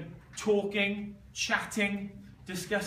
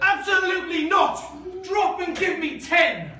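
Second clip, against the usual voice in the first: barked army order, higher and louder; drill sergeant; 11 semitones higher, 10 dB louder than usual. Acted. Teacher barks at pupil asking toilet.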